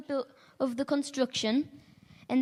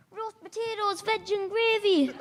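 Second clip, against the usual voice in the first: cheeky lad's reply; 8 semitones higher than usual. Retold or imitated. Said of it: "The young boy turned around and said".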